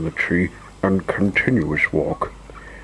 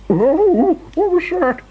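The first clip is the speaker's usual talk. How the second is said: very high, loud squeal of fright; startled shriek; 5 dB louder, slower than usual. A narrator's reading. Mister Jelly hears a twig snap, shrieking. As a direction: shriek as if jumping in terror. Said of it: "he shrieked".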